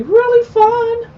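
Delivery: mock enthusiasm. Real, not acted. Sarcastic remark on possible spill.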